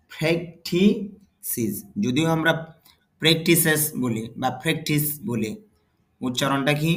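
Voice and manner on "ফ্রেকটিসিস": ফ্রেকটিসিস drawn out slowly, stress on the first syllable; slow model read of ফ্রেকটিসিস, each syllable spelled out; ফ্রেকটিসিস ধীরে, প্রথম অংশে জোর দিয়ে; slow careful pronunciation. Shown as a demonstration. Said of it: "তাহলে উচ্চারণটা হবে".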